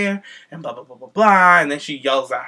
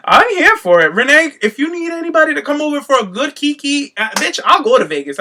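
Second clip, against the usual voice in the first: excited; 11 semitones higher, 7 dB louder than usual. Real, not acted. Excited offer to Renee.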